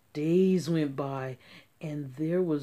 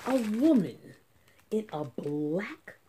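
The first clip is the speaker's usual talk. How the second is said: ordinary delivery, nothing marked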